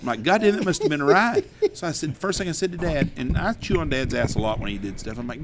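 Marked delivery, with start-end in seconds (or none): 0.0-1.4 amazed telling. Real, not acted. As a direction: savour the crash in disbelief. Wreck climax told with amazement.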